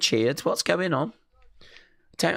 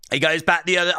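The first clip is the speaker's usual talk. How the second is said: ordinary talk, nothing marked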